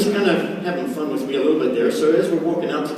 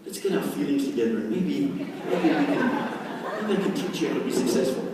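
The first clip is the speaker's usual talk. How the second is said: mock touchy-feely; 7 dB quieter than usual. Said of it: "They're not saying".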